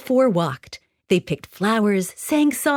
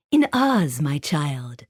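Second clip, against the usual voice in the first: fairy voice; 6 semitones lower, slower than usual. Acted. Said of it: "her voice musical and bright".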